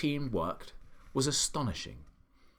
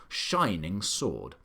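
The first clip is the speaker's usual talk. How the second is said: plain reading voice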